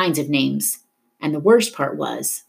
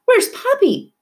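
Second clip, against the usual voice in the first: urgent question; 4 dB louder, slower than usual. A narrator's reading. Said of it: "she asked him".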